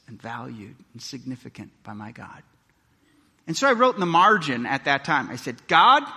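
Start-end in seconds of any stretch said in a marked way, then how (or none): 5.7-6.0 earnest call. Retold or imitated. Prayer written in the margin.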